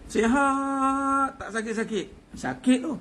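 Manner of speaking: boastful healthy man. Retold or imitated. To the audience, mocking a man who boasts of health, hammed-up pride. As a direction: puff up like someone proud he never falls ill. Voicing a proud healthy person.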